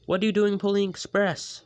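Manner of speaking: high, loud puzzled question; confused. Acted. Birdie puzzled at Flynn pulling the express, confused. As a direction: ask in disbelief.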